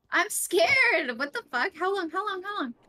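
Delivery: high, loud and anxious; panicked. Real, not acted. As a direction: fret in rising panic. Last alive, panicking.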